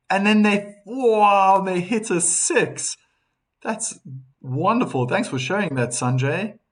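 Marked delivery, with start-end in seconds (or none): 0.1-3.0 high, loud thrill on the wow, slowing on the six; excited reading. Retold or imitated. Reading a viewer's cricket news aloud, getting excited. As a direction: build it up like a last-ball finish.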